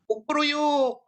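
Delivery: high, slow drawn-out kukuruyuk; rooster crow. Shown as a demonstration. Teacher shows students the Indonesian rooster crow. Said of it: "orang Indonesia bilangnya kukuruyuk".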